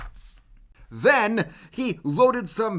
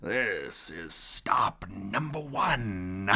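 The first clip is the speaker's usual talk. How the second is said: slow hissed whisper; 8 semitones lower, 8 dB quieter, slower than usual. Acted. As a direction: hiss it under your breath. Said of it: "the old Grinchy Claus hissed".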